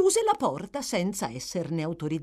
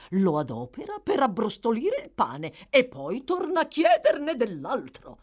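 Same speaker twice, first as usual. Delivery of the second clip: indignant beadle Bumble; 5 semitones higher, 3 dB louder than usual. A narrator's reading. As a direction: rant grandly to the matron. Bumble's long indignant tirade.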